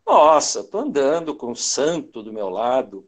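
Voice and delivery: ordinary delivery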